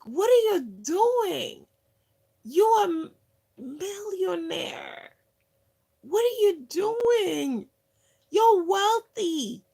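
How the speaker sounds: high, loud, slowed-down stunned outburst; imitating a friend's astonished cry at a rich man begging; shocked disbelief. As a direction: blurt it out like you can't believe your eyes. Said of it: "She has never been so shocked".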